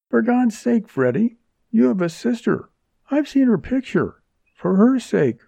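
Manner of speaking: high, beseeching appeal; desperate plea. A narrator's reading. Woman invoking Freddy's sister to win his help, pleading desperately. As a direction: beg him with rising desperation.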